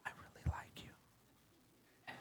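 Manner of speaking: slow, secretive whispered line; sneaky whispered come-on; mock whisper. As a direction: breathe it like a sly admirer. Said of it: "do you want someone to whisper".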